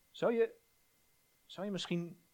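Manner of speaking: ordinary talk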